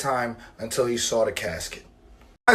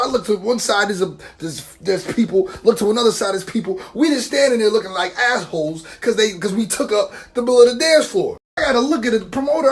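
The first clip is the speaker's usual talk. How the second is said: normal talking voice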